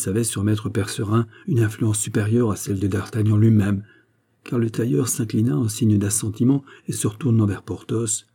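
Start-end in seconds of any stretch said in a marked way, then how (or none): none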